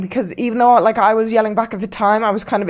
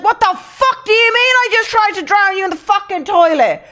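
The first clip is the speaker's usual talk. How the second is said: high-pitched, incredulous mock shriek; retold screaming; 13 semitones higher, 4 dB louder than usual. Accused friend screaming back at drunk host, outraged. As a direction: re-enact screaming back in disbelief. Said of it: "I started fucking screaming again".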